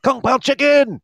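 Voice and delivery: high, loud, delighted shout; excited exclamation. Real, not acted. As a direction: blurt the dish name with glee. Echoing the dish excitedly.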